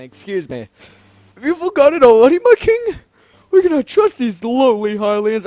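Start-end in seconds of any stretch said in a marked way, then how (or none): 1.3-5.5 put-on high, loud, outraged old courtier; old man voice, indignant. Old councilor warning the king against Hylians, high and indignant. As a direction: protest as a worked-up old man. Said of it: "a old man voice".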